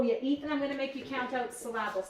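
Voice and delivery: ordinary delivery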